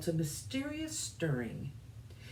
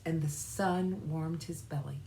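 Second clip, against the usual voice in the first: ordinary delivery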